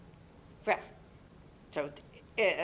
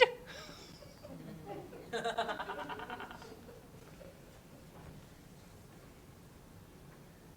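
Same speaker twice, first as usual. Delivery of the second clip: long airy giggle; long breathy laugh; 6 semitones lower, 21 dB quieter than usual. Acted. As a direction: let a breathless chuckle trail away. Neighbor leaving, pleased.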